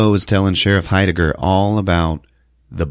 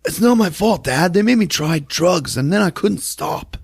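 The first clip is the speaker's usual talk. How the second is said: high, hammed-up pleading kid voice; whiny teen son; 11 semitones higher than usual. Son pleading with his dad, hammed-up whine.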